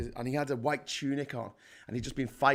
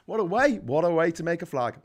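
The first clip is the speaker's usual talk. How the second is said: ordinary delivery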